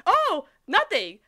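high, drawn-out fake casualness; feigned innocence. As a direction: stretch it out, playing innocent. Lincoln feigns innocence to Lori.

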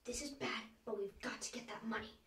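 urgent low whisper; hushed whisper. Acted. Burglar to partner while sneaking in, hushed whisper. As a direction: whisper it so nobody in the house hears.

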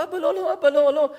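high, pleading protest; mock tearful child refusing; acted crying daughter. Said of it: "למה את בוכה?".

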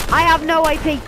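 "I have no A P" shouted high and loud. Real, not acted.

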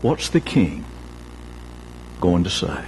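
low, hushed, hopeless question; quiet despair. Acted. Servant walking back to the palace asks himself, low and hopeless. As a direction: let it sink into hopelessness.

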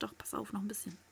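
very soft tense whisper. Real, not acted. Sneaking player whispering a warning.

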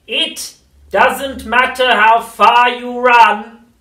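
very slow, throaty, menacing crone; throaty witch whisper. A narrator's reading. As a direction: lean in and menace. Grandma menacing.

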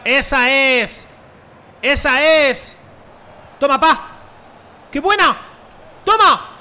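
loud, high cheering; celebración a gritos. Real, not acted. (gritos eufóricos de celebración)

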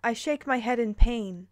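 ordinary talk, nothing marked

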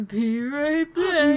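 high slow game announcer. Pretend. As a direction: drawl it like a game voice. Game voice line imitation.

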